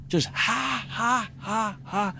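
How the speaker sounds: high airy ha ha ha; cartoon laugh. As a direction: bounce out a cartoon cackle. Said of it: "like a cartoon laugh".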